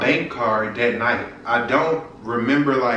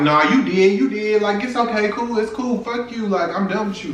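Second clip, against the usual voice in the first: high-pitched, fed-up retort; retold angry breakup; 7 semitones higher than usual. Girlfriend breaking up with a lying boyfriend, retold high and fed up. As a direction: cut him off, higher and indignant.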